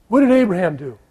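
normal talking voice

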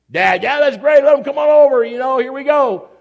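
loud, high, keen welcome; excited. Acted. Keen spouse welcomes friends for the game, excited. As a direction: call it out like an eager host.

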